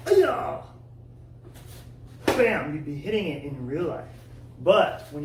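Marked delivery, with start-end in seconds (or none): 0.1-0.6 sharp high yell with the strike; kiai shout. Shown as a demonstration. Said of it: "you would be going". 2.3-2.7 loud. Shown as a demonstration. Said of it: "you would be going".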